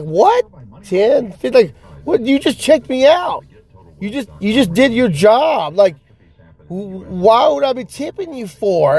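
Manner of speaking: high, baffled questioning; acted bewildered protest; incredulous. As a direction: question it in rising disbelief. Acts disbelief at tip request.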